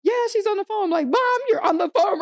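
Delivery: high-pitched nervous reassurance; high anxious voice. Retold or imitated. Desperate to stranger and mom.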